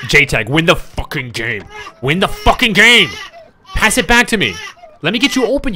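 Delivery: loud, high raging yell, building; furious shout. Real, not acted. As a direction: scream orders at a useless teammate. Raging at teammate J-Tag.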